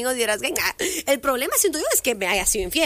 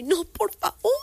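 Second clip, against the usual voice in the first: mock pleading; 7 semitones higher, slower than usual. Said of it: "yo haciendo el drama".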